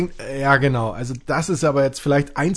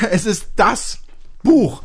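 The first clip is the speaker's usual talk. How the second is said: exasperated, higher and louder; indignant; 5 semitones higher, 3 dB louder, much slower than usual. Real, not acted. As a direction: insist on it, fed up.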